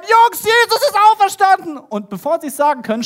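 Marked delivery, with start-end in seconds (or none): 0.0-1.8 high, loud bursting-in announcement; excited shout. Acted. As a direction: disciples burst through the door to tell their friends, shouting excitedly. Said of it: "wollen gerade reinschreien".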